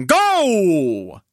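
long, drawn-out starter's cry; race-start shout, held long. Acted. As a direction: send the racers off with a stretched call.

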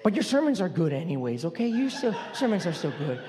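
low, lightly voiced sweet lady; a hint of a well-meaning church lady; quoting a church lady. Church lady encouraging the pastor after the sermon, lightly voiced. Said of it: "She goes like".

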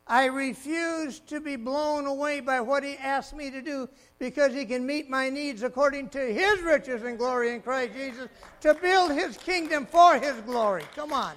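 loud building preacher cadence; preaching at full force. Real, not acted. Preacher declaring faith to the congregation, full force. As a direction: drive it home to the crowd.

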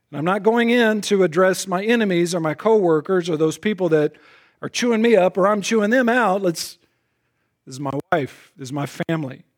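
usual speaking voice

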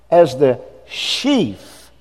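sheaf said very slowly and clearly; slow deliberate sheaf; sheaf slowly spelt out. Shown as a demonstration. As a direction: draw out the word sheaf so they hear it. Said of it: "S E, S H E A F".

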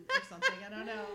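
breaking into high laughter mid-sentence; laughing. Real, not acted. Podcaster cracking up at her own duchess joke, laughing. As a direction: laugh through the words.

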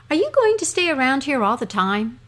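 a small dog pestering his master with questions; eager dog. Acted. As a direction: puppyish high voice, pleading. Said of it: "asked the dog".

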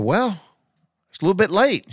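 high, loud, fed-up retort. Retold or imitated. Telling wife it's too late now, exasperated and loud. Arguing with wife over car.

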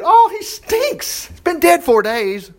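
high, slow squeal of disgust as Martha; Martha protesting. Acted. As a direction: let Martha recoil at the smell, dragging it out.

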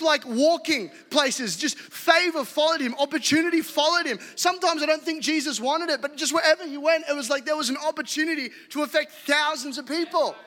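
high, loud fired-up sermon; rally the church with fire; full-force preaching. Acted.

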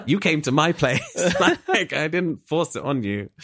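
words broken up by real laughter; can't keep a straight face; laughing. Real, not acted. Laughing while retelling own retort.

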